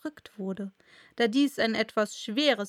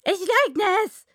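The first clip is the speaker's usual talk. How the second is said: high, loud, emphatic denial; leugnender Faselhase; 9 semitones higher, 8 dB louder than usual. Acted. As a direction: protest loudly as the March Hare. March Hare denies loudly. (laute, hohe Faselhasenstimme, abstreitend)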